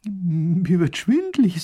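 high, slow, swooning voice; faint with dizziness. Acted. Lady overcome by the monster tale, dizzy. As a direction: draw it out as if about to swoon.

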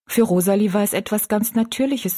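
ordinary delivery, nothing marked

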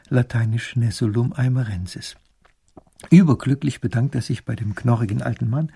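plain reading voice

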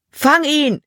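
high, urgent call; shouted order. A narrator's reading. Another slave catcher orders the chase, calling out high. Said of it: "rief ein anderer".

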